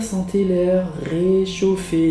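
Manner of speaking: ordinary talk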